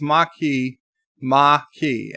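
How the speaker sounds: Maquis slowly as "mah-key". Shown as a demonstration. Host reads a viewer's correction, Maquis as slow "mah-key". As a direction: spell out Maquis in two open syllables. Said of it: "M A H K E Y".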